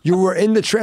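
high, loud incredulous questioning; shocked uncle. Retold or imitated. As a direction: imitate a stunned uncle demanding an explanation. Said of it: "he was shocked".

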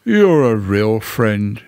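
slow, mournful donkey voice; doleful gratitude. A narrator's reading. Eeyore praising his friend Pooh, dolefully.

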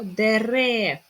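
"Дерев" loud on the final syllable; "Дерев", stressing the second е firmly. Shown as a demonstration. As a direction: punch рев in "Дерев". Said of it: "Наголос на другу е".

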